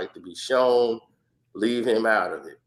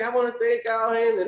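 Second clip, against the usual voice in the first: grateful fighter; 10 semitones higher than usual. Retold or imitated. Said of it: "Everybody's like".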